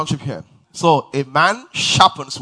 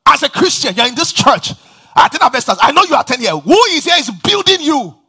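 louder, higher preacher's call to the congregation; preaching at full force; 7 semitones higher, 9 dB louder than usual. Acted. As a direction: preach it out, building fire.

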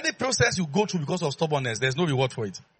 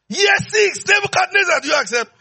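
high ringing shout of the question; calling shout; 14 semitones higher, 7 dB louder than usual. Acted. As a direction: shout the demand across the years. Preacher voicing God's yearly question.

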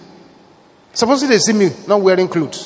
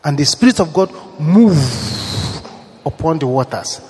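normal talking voice

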